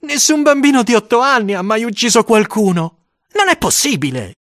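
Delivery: put-on high child's voice, insisting; worried small boy. A narrator's reading. Eight-year-old convincing himself he is no killer, high and anxious. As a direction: argue with yourself as a small boy, high-pitched. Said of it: "mi dicevo".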